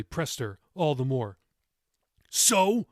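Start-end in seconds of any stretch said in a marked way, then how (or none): 2.3-2.9 loud, high impatient demand. A narrator's reading. Grandson pressing sobbing grandmother, loud demand. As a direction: push her for an answer. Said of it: "he demanded".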